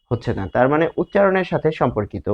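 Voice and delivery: ordinary talk, nothing marked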